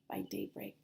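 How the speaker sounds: hushed, breathy whisper; whispered narration. A narrator's reading. Teacher reading aloud to children, hushed whisper. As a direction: whisper it to build suspense.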